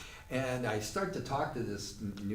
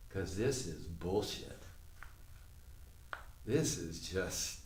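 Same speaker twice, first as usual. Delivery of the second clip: slow, low-pitched dismay; stunned disbelief; 5 semitones lower, 6 dB quieter, slower than usual. Retold or imitated. Playgoer to himself during the ovation, slow stunned disbelief. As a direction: say it slowly, as if losing your grip.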